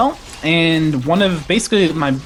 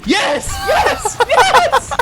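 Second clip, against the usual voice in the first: excited cheering; 3 dB louder, much slower than usual. Real, not acted. Cheering a great play.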